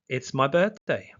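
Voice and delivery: ordinary delivery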